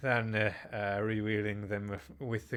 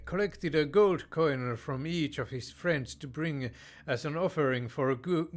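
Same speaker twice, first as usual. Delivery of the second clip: usual speaking voice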